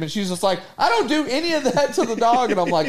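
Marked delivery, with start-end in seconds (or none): 0.8-2.5 higher, indignant denial; raising her voice. Retold or imitated. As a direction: protest as an accused mom. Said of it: "you're already raising your voice".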